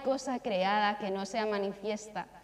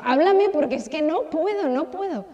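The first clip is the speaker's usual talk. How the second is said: louder anguished plea; retold desperate prayer, louder; pleading cry; 5 semitones higher, 10 dB louder than usual. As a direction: beg God to speak. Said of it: "me puse a llorar".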